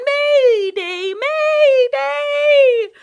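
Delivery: very high, loud squawking cry; bird shriek. Acted. Bird warning everyone of danger, shrieking. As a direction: screech the alarm like a panicked bird. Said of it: "and then again".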